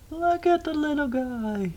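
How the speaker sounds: cutesy cooing. Real, not acted. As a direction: gush slowly in a tiny high voice. Cooing over a little animal.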